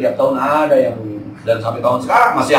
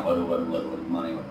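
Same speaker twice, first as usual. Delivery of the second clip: much softer, lower, fretting to himself; a borrower panicking under his breath; worried muttering; 9 semitones lower, 11 dB quieter, much faster than usual. Retold or imitated. Retelling fear over unpaid debt. (gumaman cemas, jauh lebih pelan)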